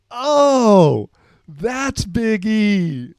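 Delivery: loud, high and drawn-out teasing reveal; mock announcement. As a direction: present him like a joke, slowly. Teammates jokingly name quiet Justin.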